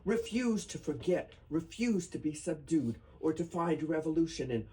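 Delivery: earnest, rising poetry recital; solemn reading. Acted. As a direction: read it as a tribute that builds. Reads protest poem aloud.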